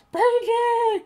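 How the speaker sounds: high, loud mock scream of Benji's name; retold frantic cry to Benji; imitating her scream. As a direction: scream a warning through a gag, hammed up. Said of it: "she's literally screaming, like".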